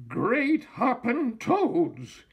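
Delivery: high, slow astonished exclamation; startled witch cry. A narrator's reading. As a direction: gasp it out in a high old-witch squeal. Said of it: "she cried".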